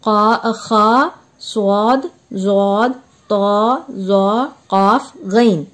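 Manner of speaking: letters called one at a time; teacher repeating the seven letters for students to note; Arabic letter recitation. Shown as a demonstration. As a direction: list का, खा, सौद, जौद, तौ, जौ, काफ, गैन with pauses. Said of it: "सात हुर्फ है".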